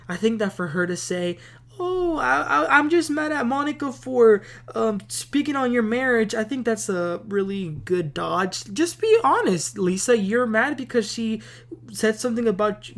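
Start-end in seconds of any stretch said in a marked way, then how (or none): 1.8-6.3 mocking imitation. Mocking Lisa's excuse. 8.7-12.9 exasperated. Real, not acted. Exasperated plea to Lisa.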